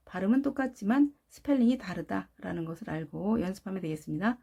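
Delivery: ordinary talk, nothing marked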